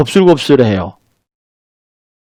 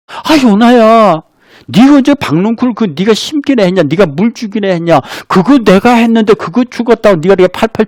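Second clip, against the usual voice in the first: God coaxing Jonah; 6 semitones higher than usual. Acted. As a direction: coax a sulking prophet gently, pitched up. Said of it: "하나님이 요나에게 쩔쩔매세요". (쩔쩔매며 요나를 달래는 하나님, 높은 목소리)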